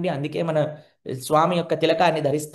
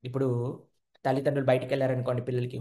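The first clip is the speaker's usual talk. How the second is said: usual speaking voice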